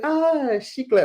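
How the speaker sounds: high delighted kid voice, imitated; excited kid. Little boy thrilled to get candy, high and eager. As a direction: squeal it like a kid handed candy. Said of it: "he was like".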